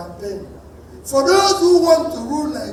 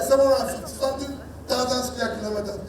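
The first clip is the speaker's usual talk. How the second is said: normal talking voice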